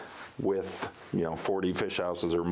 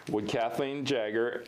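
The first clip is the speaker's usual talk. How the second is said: ordinary talk, nothing marked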